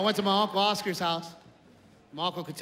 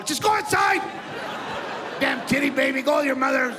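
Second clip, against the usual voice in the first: higher, louder exasperated yell; mock dad shout; 6 semitones higher, 4 dB louder than usual. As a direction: shoo the kid off angrily. Dad yelling kid away.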